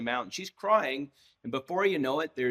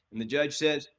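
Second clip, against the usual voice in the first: normal talking voice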